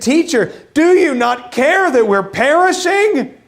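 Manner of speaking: high, pleading cry of alarm; panicked disciples. Acted. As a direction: beg the sleeping teacher in a panic. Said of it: "They woke him up and they said".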